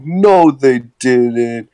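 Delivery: hammed-up slow insistence; mock indignant denial. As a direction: imitate a stubborn denial, stretching the words. Acting out Kyle's stubborn denial.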